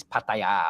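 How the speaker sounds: "Pattaya" as pat-ta-YAA; Thai pronunciation. Shown as a demonstration. Explaining the way it is spelt in Thai, Thai pronunciation. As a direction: end "Pattaya" on a long open AA. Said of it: "the ways the Thai say it".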